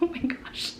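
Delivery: soft breathy whisper of awe; hushed whisper. Real, not acted. Recalls crying over her baby.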